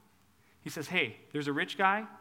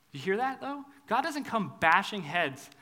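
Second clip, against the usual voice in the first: ordinary delivery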